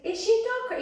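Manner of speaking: mock eight-year-old asking; imitating a little boy. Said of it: "And he said".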